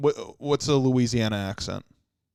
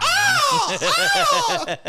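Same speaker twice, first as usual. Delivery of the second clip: mock T-Rex scream, much higher and louder; 3 dB louder than usual. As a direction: roar it like a dinosaur popping out. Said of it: "they're like".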